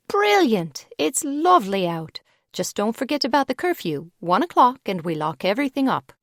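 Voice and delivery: cheery British reply, high at the start; London girl breezily warning about curfew; British accent. Acted. British advisor warns Megan of curfew, British accent. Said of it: "Her accent was delicious".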